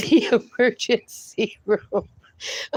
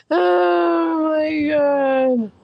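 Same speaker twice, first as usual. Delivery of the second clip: drawn-out laughing sigh; 3 semitones higher, much slower than usual. Real, not acted. Still laughing.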